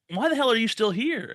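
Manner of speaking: high-pitched indignant demand; acted-out exasperated question; retold indignant nurse. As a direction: put on a nurse's incredulous challenge.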